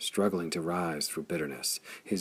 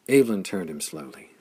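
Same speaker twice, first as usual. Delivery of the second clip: ordinary delivery, nothing marked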